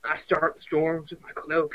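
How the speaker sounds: slow, soft pickup line; slow, soft cheesy line. Acted. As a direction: purr it slowly and softly.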